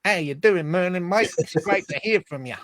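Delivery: a knowingly bad, lightly done Australian accent. Host greeting caller Merlin again in a mock Australian accent. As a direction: have another go at an Aussie accent and miss.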